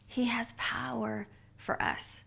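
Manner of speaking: hushed whisper, no voice. Acted. Preacher whispering a truth to her listeners.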